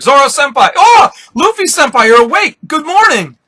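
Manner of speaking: high, loud squeals of fan excitement; hammed-up overjoyed fanboy voice; fangasming. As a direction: gush like a starstruck superfan greeting his idols. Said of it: "Bart's fangasming again".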